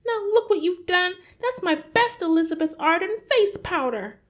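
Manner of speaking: high, loud, upset complaint; aggrieved wife wailing loudly; scolding shriek. A narrator's reading. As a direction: screech at your husband over the spill. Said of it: "shrieked the mother".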